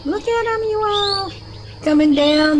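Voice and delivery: very high, loud, thrilled exclamation; excited squeal. Real, not acted. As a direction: gush up high at the sky. Thrilled at UFOs on video.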